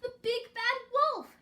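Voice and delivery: high, loud urgent warning; shouting. A narrator's reading. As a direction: cry out the danger. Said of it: "Gertie shouted".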